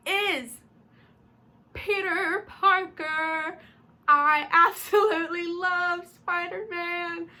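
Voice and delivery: high, slow, drawn-out adoration; gushing reveal. Real, not acted. Fan revealing Spider-Man as a favorite, gushing. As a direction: stretch every word with delighted emphasis.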